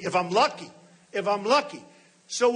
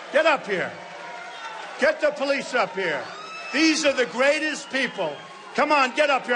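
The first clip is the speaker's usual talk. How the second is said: calling out; 4 semitones higher than usual. Real, not acted. Calls police up on stage.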